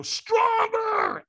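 screaming trainer. Retold or imitated. Trainer at the firewalk screaming at him, full shout. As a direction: scream it to break his hesitation. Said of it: "So he screams at me".

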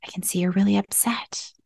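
soft, voiceless whisper; hushed de-escalating whisper. Shown as a demonstration. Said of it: "Maybe we're whispering".

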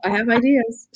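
coy, laughing tease. Real, not acted. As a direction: laugh while hinting at a secret. Teasing a new project, laughing.

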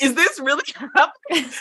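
high, laughing words, almost crying; laughing disbelief. Real, not acted. Telling the deer chase to a friend, laughing hard. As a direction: laugh through the question in disbelief.

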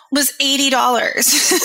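"was eighty dollars" said high through a real laugh; laughing disbelief. Real, not acted. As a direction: laugh it out, amazed at the price. Laughs at old cost.

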